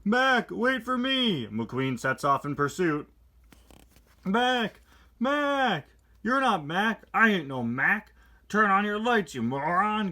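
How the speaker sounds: high, loud frantic calling; hammed-up McQueen. McQueen chasing the wrong truck, high frantic shouting. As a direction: shout after the truck in panic.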